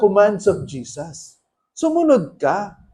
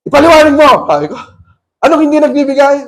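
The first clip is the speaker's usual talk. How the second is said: much louder and higher, demanding; 6 semitones higher, 9 dB louder, much faster than usual. Retold or imitated. Preacher demanding the demon explain, loud and high.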